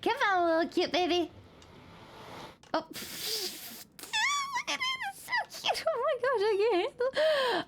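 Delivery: squealing high over cute pet; overwhelmed by cuteness. Real, not acted. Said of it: "I can't handle how cute".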